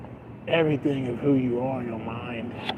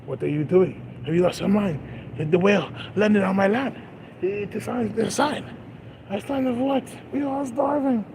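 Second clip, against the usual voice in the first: climbing higher into an outraged warrior voice; mock Viking warrior; 6 semitones higher, 3 dB quieter than usual. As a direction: ham up the Viking chieftain's warning. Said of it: "He's like".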